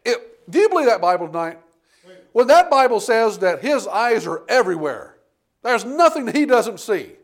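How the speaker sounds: rising preacher's cry, loud and high; preaching at full force. Acted. Preacher crying out to the church about sin. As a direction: preach it out to the congregation.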